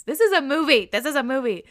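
loud, high excited exclamation; thrilled outburst. Real, not acted. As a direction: burst out, thrilled by the story. Thrilled reaction to listener's story.

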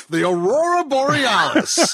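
high, slow, drawn out; Skinner quote voice. Retold or imitated. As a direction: stretch each syllable nervously. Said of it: "every time I quote that".

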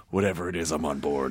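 low, softened, won-over voice; low and soft, instantly persuaded; mock swooning agreement. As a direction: drop low as if completely sold. Voices swayed listeners' reaction.

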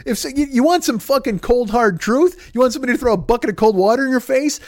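ordinary talk

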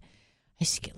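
unvoiced whisper in the middle of the quote. Host recalling his party days, whispering. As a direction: drop to a breathy whisper for a beat.

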